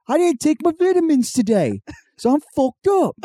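high, swearing hammed-up Cockney; mock Cockney accent. Cohost parodying a British bake-off contestant, mock Cockney accent. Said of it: "Cockney accent".

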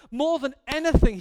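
preacher's emphatic peak; rising climax. Real, not acted. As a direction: build to the top of the list.

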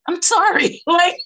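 high, loud burst with a laugh; excited outburst. Real, not acted. Guest bursts out laughing to her host, loud and high. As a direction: blurt it out, laughing.

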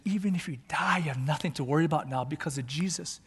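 ordinary delivery

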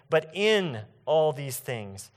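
slow, with the word in stressed; slow contrast, stressing in. Shown as a demonstration. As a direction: dwell on in, not by. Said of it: "not by escaping all these things".

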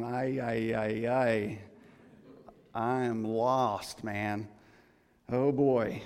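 a hint of a dazed, lost man, slow; mock lost man. Preacher to congregation, lightly voicing a lost self-centered man. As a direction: play him confused and lost. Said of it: "you should be going".

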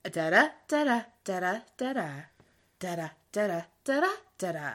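"Da-da, da-da" with a steady weak-strong beat; iambic rhythm demo. Shown as a demonstration. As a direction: tap out "da-da" as iambic pentameter. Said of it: "That's a rhythm".

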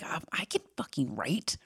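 irritated whisper under the breath. Retold or imitated. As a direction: hiss it quietly, annoyed. Irritated inner reply, whispered.

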